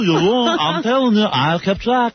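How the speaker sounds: high, drawn-out mock protest; mock auction customer. Host playing a car buyer at the joke auction, mock protest. Mock Gary haggling over cars.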